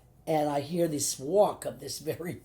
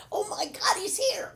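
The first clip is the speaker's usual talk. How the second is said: very high, excited mock squeal. Actress acting out her fast song lyric, squealing high. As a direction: squeal it like a thrilled fan. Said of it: "He's here, he's here".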